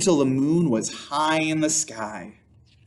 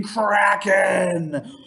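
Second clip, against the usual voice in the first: dramatic shout; 3 semitones higher than usual. A narrator's reading. Reading the scary climax to listeners, a big shout. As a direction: boom out the monster's name.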